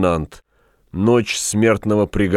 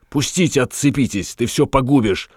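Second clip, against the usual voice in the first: higher-pitched, agitated protest; agitated objection; 6 semitones higher than usual. A narrator's reading. Shopkeeper wrestling with his rival, agitated objection. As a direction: object urgently to being held. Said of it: "возражал Стамодор".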